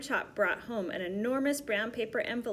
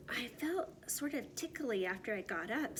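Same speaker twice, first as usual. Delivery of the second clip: much softer, breathy whispered reply; soft answer; 8 dB quieter than usual. Acted. Stanley telling the doctor, whispered soft reply. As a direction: murmur it almost under your breath.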